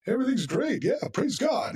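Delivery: high, sing-song fake church positivity; fake cheerfulness. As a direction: put on a mock everything-is-great voice. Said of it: "fake, like".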